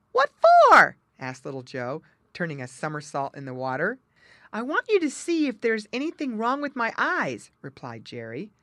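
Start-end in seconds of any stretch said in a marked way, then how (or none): 0.1-1.0 very high, loud character shout; shouted reply. A narrator's reading. Little Joe Otter calling back from the water, shouting. 4.5-7.5 high, louder animal character; muskrat character voice. A narrator's reading. Jerry Muskrat asking his otter friend for help, high and loud.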